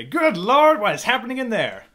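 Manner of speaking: high, loud startled exclamation; shocked at the glow; alarmed. Acted. Superintendent startled by the kitchen glow, loud alarm.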